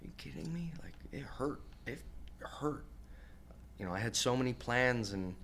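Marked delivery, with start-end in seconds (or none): 0.0-0.7 soft, high, stunned; quiet retold shock; hushed disbelief. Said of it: "And I'm like". 0.8-2.8 hushed. Real, not acted.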